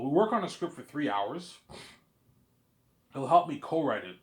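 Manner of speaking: ordinary talk, nothing marked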